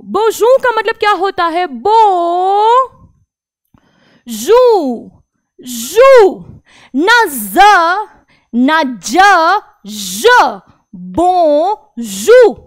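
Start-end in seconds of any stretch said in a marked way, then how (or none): 1.7-12.6 syllable drill; French bonjour drill. Shown as a demonstration. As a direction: break bonjour into slow syllables. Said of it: "आप मेरे बोलने पर ध्यान दीजिए".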